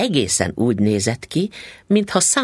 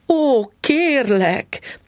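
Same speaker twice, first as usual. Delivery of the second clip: drawn-out high pleading cry; wife crying out to stop her husband; 13 semitones higher, 3 dB louder, much slower than usual. Acted. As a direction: stretch the plea out high and slow. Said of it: "kiáltott fel Mrs. Foster".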